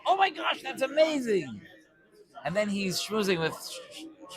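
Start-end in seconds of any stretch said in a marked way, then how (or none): 0.1-1.6 much higher, squealing surprise; hammed-up gasp of delight; fake amazement. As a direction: overplay astonishment at old news. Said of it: "If I go".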